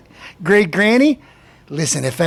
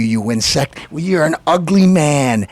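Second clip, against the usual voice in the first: normal talking voice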